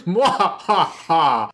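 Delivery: slow, drawn-out evil vampire laugh; vampire cackle. Acted. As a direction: stretch out a villain's mwahaha. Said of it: "use my vampire impression".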